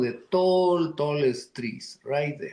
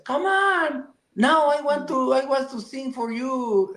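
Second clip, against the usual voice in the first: much higher, squeaky crow voice; eager crow; 11 semitones higher than usual. Acted. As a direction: chirp it like a proud little bird.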